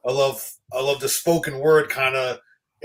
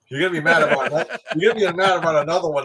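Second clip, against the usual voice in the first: laughing; faster than usual. Real, not acted. Teasing friend about his picks.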